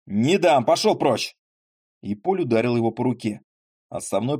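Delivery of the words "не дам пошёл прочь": angry refusal. Acted. Поль refusing angrily.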